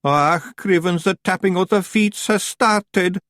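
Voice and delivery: high, slow Scots pixie moan. Acted. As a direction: moan in despair. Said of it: "moaned Daft Woolly".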